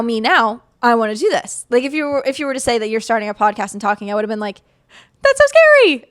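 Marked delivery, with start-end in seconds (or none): 5.2-6.0 high, loud frightened squeal; mock scared. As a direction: squeal it like a panicked past self. Past self imagining starting podcast.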